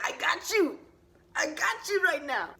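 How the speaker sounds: high, punchy hype-man God; hyped reassurance. Acted. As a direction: answer the prayer with big swagger. God answering the boy's plea.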